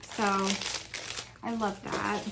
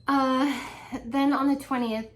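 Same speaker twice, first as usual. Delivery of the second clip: ordinary talk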